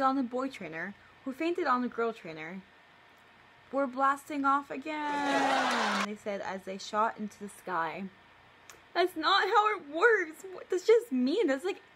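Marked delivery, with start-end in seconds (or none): 3.7-6.0 slow, stretched, laughing catchphrase; a laughing hammed-up villain exit line; Team Rocket catchphrase. 8.9-11.8 much higher and louder, protesting; high, loud outrage, slightly slowed; outraged disbelief. Real, not acted. Outraged at her childhood story.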